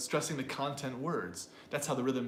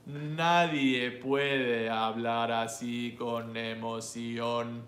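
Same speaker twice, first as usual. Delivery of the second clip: slow, flat robot monotone; 3 semitones lower, 3 dB louder, much slower than usual. Shown as a demonstration. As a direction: drone it slowly like a robot. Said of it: "monotone voice".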